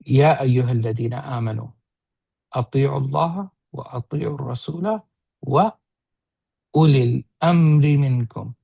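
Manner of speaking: fast recited Quranic Arabic verse; Quran recitation. Acted. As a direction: chant the verse quickly, like scripture.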